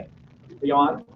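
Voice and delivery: usual speaking voice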